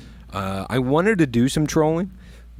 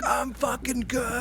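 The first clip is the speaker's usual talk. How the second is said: high, soft, slow squeeze of words; strained insistence; 12 semitones higher, 6 dB quieter, slower than usual. Retold or imitated. Angler reassuring crew mid-fight, high strained voice. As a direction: act out insisting through the strain, drawn out.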